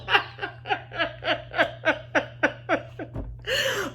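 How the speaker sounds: real helpless laughter; high wheezing laugh; laughing fit. Real, not acted. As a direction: crack up for real. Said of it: "I'm laughing because of the voice".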